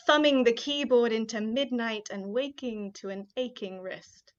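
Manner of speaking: ordinary talk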